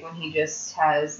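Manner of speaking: ordinary delivery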